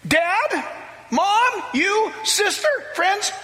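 excited pleading. Retold or imitated. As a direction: urge the family to come now. Kaha calling his family.

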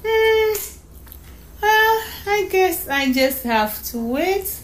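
put-on high hum, then slow child's voice; slow, high, reluctant acceptance; resigned little girl. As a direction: give in like a small girl. Child resigns herself to waiting.

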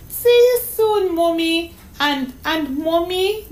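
put-on bright-eyed child echoing a farewell; eager little girl. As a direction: call out to Mommy like a small girl. Child echoes mother's goodbye.

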